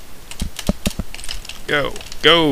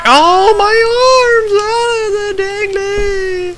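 mock anguish; 3 dB louder, slower than usual. Character's arms flop around.

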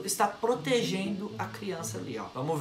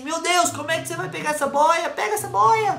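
higher, louder mock dog voice, urging; voicing the dog's thoughts; 8 semitones higher, 5 dB louder than usual. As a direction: play the worried dog egging him on. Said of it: "Ele deve tá pensando assim". (voz imitando o pensamento do cachorro, mais aguda e alta)

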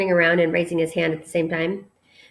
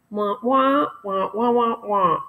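muffled trombone-like wah wah; Charlie Brown adult; slower than usual. Retold or imitated. Kid mimicking grown-ups in Charlie Brown, mock wah wah. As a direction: mimic a teacher heard underwater. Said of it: "talking underwater".

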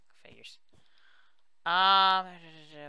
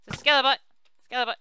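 excited callout; 10 semitones higher, 11 dB louder, much faster than usual. Real, not acted. Calling out the enemy.

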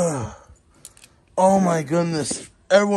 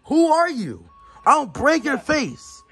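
higher-pitched, heated character voice holding back a brawl; hyped-up fighter character; 6 semitones higher than usual. Acted. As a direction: play a riled-up fighter getting pulled apart.

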